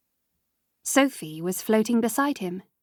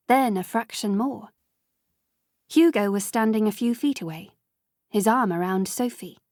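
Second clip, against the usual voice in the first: usual speaking voice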